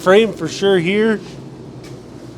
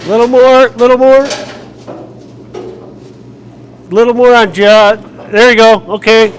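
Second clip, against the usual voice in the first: raised voice guiding a lift; calling out; 5 semitones higher, 8 dB louder than usual. Real, not acted. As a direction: call out directions across the garage.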